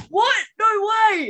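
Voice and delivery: high, loud cry of disbelief; shocked disbelief. Retold or imitated. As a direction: gasp out no way. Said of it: "I was like".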